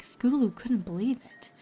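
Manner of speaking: ordinary delivery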